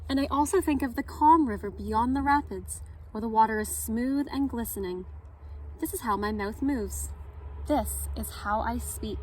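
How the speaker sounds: gentle read-aloud storytelling; story narration. A narrator's reading. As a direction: read it like story time for kids. Reads picture book at story time.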